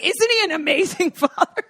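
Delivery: high, laughing mock-gush. Imagining praising a grand gesture, high laughing gush. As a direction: rave with a laugh. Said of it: "I would be like".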